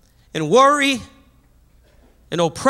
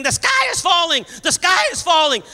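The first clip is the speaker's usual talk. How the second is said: high, shouting panic; panicked chicken scream; 7 semitones higher than usual. Acted. Chicken Little screaming in panic across the barnyard. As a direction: squawk it out like a terrified chicken. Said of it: "he starts screaming".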